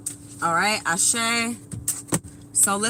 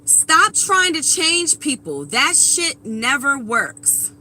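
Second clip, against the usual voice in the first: emphatic repeat; 3 semitones higher, 3 dB louder, slower than usual. Real, not acted. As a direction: drive each word home slowly. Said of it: "Let me repeat this".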